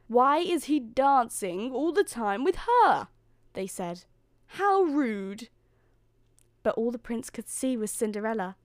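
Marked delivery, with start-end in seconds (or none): 0.1-3.1 loud, slow, higher-pitched jealous complaint. A narrator's reading. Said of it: "the other maidens at the ball grew jealous". 4.6-5.5 high, loud indignant exclamation; jealous. A narrator's reading. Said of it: "grew jealous".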